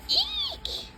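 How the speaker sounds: sudden high yelp of pain; startled eek. Acted. Owner grabbed by the cat, high startled yelp. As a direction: jump out of the sentence with a squeal. Cat attacks during handling.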